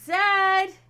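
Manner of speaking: high, loud shout of a name; calling out. Real, not acted. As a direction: holler to someone who can't hear you. Calling a player who can't hear.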